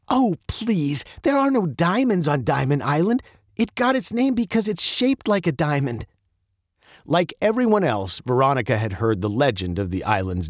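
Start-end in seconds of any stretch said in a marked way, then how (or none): 0.1-6.1 high, dismissive scoff. Acted. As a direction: wave off the legend. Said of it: "Veronica sniffed".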